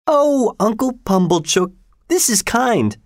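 high, gushing sister voice. Acted. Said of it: "said my sister".

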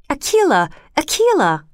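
Akela called out high with a sharp drop; calling out to a friend in surprise; storybook boy's glad call; excited shout. A narrator's reading. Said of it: "shouted Mowgli".

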